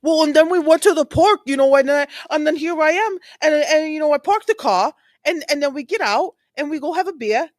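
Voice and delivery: high excited run-on imitation of Karen Reed; Karen Reed impression. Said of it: "she's just like".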